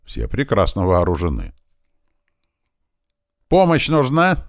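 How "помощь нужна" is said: high, loud, eager offer; joyful. A narrator's reading. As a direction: ask it gladly, pitched high. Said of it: "радостно спросил Костя". (радостно, громко и высоко)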